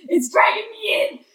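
high, loud, panicked cry; panicked crying shout. Acted. Mickey panics as something drags him in, crying shout. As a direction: scream it out as you're dragged under. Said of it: "and he's like".

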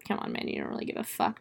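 quick, hushed scoff; a fast whispered jab at the screen; whispered aside. Real, not acted. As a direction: mutter it under your breath, fast. Aside mocking Weir, unvoiced.

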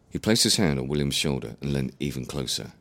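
ordinary delivery, nothing marked

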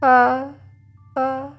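high fading echo of the last word; mock echo. The hiding victim faking an echo, high mock echo. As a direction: repeat it like an echo in the woods. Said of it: "Y tú".

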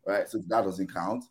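ordinary talk, nothing marked

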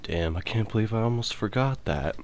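normal talking voice

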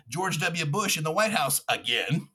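normal talking voice

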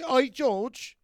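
high mocking Cockney greeting; mock Cockney accent. George mocking a Cockney fan's accent, high and hammed-up. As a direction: ham up a Cockney working-class voice. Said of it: "he was mocking this working class guy's accent".

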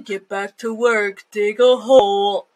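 slow, drowsy mumbled order; lightly voiced sleepy mumble; slow mock mumble. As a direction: mumble it slowly like a half-asleep giant. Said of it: "Sloth keeps mumbling".

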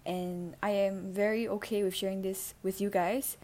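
ordinary talk, nothing marked

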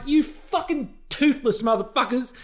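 high, hammed-up furious rant; mock outraged rant. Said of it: "a little bit agitated there by the Twilight movies".

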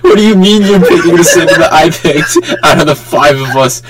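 high, laughing indignation; laughing mock outrage. Real, not acted. Laughing at friend copying his turtle pick. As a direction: fake outrage through laughter.

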